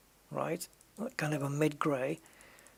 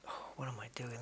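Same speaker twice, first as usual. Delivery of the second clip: much softer unvoiced whisper, lower; whispered self-doubt; 4 semitones lower, 12 dB quieter, faster than usual. Real, not acted. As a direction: mutter it in disbelief at yourself. Doubts own crazy colour choice.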